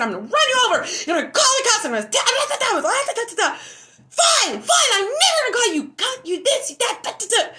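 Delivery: high, loud frantic yelling, breaking into laughter; retold laughing scream. Threatening the stalker, shrieking and laughing.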